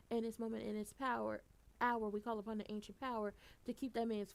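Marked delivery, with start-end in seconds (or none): none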